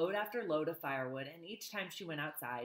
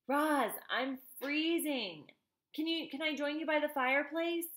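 weak freezing voice; 9 semitones higher, 4 dB louder than usual. Acted. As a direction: plead weakly from the cold. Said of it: "said a weak voice".

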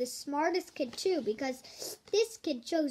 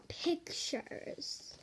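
whispered; 10 dB quieter than usual. Acted. Repeats title in whisper.

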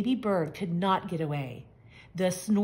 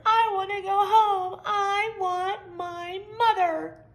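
high, crying, slowing down; tearful baby bird; 9 semitones higher, 4 dB louder than usual. A narrator's reading. Baby bird wants to go home, high and crying. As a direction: whimper for mommy.

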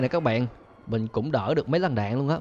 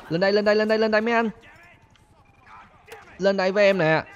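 higher, louder come-get-me call; teasing call; 7 semitones higher, 3 dB louder, faster than usual. Real, not acted. Calling the police up to him, loud taunt. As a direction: bait them cheekily.

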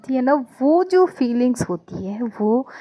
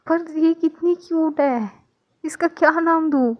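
higher, excited gushing; delighted cooing; 6 semitones higher than usual. Acted. Mother gushes over the baby's name, pitched up. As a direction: gush over how cute she is.

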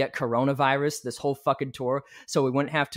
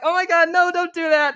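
mock panic; 13 semitones higher, 6 dB louder than usual. Panicking as record is played.

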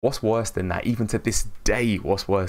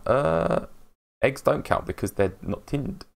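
normal talking voice

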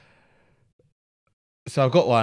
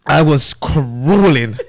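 ordinary talk

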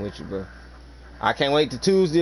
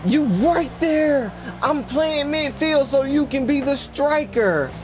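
higher and slow, drawn-out tease; mock-patient explainer; 10 semitones higher, slower than usual. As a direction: spell it out sarcastically in a put-on higher voice. Continues mocking voice, slow.

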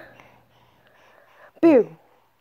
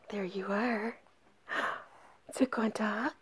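whisper; 5 semitones lower, 16 dB quieter than usual. Real, not acted. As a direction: breathe it gently to the dog.

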